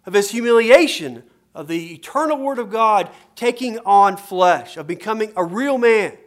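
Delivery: full-force sermon voice; fervent preaching. Acted. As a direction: drive the point home to the congregation.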